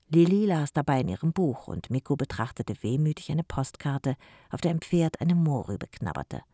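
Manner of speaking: usual speaking voice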